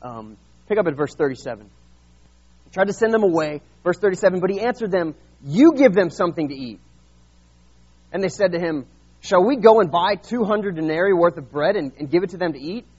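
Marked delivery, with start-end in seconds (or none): 2.6-12.8 louder, lifted Bible reading voice; scripture reading. Acted. Preacher reading the Gospel to his church, raised voice. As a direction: read the passage out with weight.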